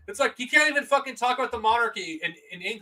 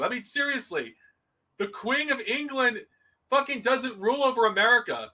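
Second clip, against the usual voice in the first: ordinary talk, nothing marked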